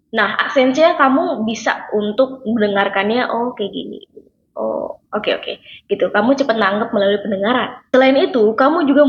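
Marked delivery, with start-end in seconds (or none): 3.3-5.6 softly acted dawning understanding; quiet realization. Language learner catching on by ear, quiet realization. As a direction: murmur it to yourself as it clicks. Learner taking in what they hear.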